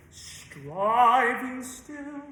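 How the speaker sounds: slow emphatic demo. Shown as a demonstration. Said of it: "So striving still".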